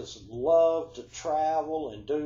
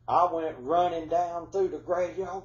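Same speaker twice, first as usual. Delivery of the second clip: normal talking voice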